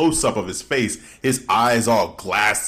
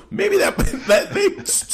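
laughing. Real, not acted. Laughing mid-sentence.

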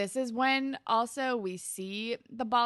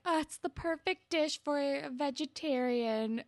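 high, gushy imitation; mock gushing; 7 semitones higher than usual. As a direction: gush over it in a higher voice. Voicing Ellie's gushing praise.

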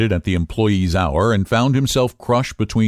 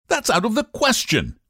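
impatient. A narrator's reading. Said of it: "he returned impatiently".

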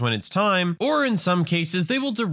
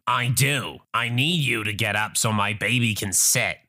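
lowered haughty Karen voice; entitled mother; 5 semitones lower than usual. Acted. Entitled mother demands the chair for her baby, low and haughty. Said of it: "Entitled mother".

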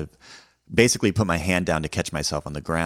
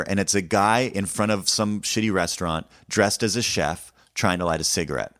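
ordinary delivery

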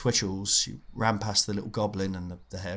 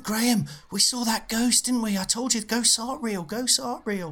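put-on much higher, whiny kid voice; pleading nerdy boy; 14 semitones higher than usual. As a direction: squeak it out like a desperate nerd. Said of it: "And he went".

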